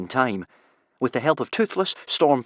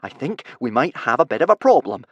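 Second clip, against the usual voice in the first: higher, measured voice holding down panic; forced calm; 5 semitones higher, 5 dB louder than usual. Acted. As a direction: try to stay calm while wanting to scream. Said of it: "I'm trying to stay calm".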